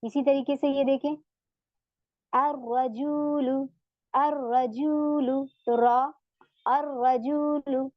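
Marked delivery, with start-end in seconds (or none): 2.3-5.5 अर्रजूलु slow, with a doubled r and no l; silent lam. Shown as a demonstration. Teacher shows the students a sun letter. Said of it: "लाम नहीं पढ़ा जा रहा". 6.7-7.9 अर्रजूलु slow, with a doubled r and no l; silent lam. Shown as a demonstration. Teacher shows the students a sun letter. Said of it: "लाम नहीं पढ़ा जा रहा".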